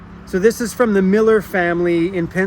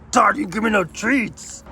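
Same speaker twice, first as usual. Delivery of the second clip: higher, pleading dog complaint; dog's voice, higher; 4 semitones higher than usual. Pretend. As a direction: push the dog's whine up a notch. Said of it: "He's like".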